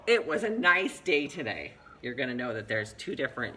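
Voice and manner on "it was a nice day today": higher, louder, drawn-out exclamation; exclamation reading. Shown as a demonstration. Teacher showing how an ending mark changes meaning, exclamation. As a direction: read it with a big exclamation mark. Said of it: "trying to convey two different meanings".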